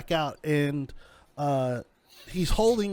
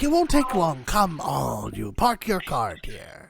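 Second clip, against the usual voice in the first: put-on old fortune teller. Old woman coaxes the party to park, slow crone voice. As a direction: coax them, slowing right down. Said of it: "She's like".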